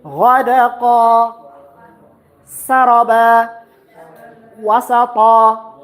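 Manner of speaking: ghadaqa, saraba, wasata each ending on a long a; prolonged ending. Shown as a demonstration. Teacher models pausal forms, stretching the final vowel. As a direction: draw out the final vowel slowly. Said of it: "You prolong a little".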